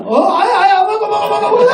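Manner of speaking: high, loud, hurried eat-up cries; flustered excited urging. Retold or imitated. Nervous father hurrying children to eat the chicken, loud and giddy. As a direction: cover your panic with loud cheer.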